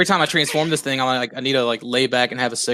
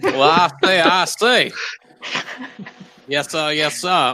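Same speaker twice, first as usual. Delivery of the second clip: mock Southern accent; 3 semitones higher, slower than usual. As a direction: play a blustery Southern gent. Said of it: "Southern accent".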